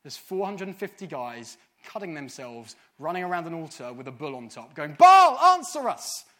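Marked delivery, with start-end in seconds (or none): 4.9-6.2 high, loud, drawn-out wail to a god; desperate calling out. Acted. Four hundred and fifty guys running round an altar, loud drawn-out cry. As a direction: bellow it slowly, imitating frantic worshippers. Imitating frantic prophets calling Baal.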